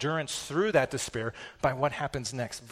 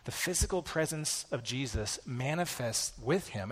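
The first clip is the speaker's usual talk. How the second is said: ordinary delivery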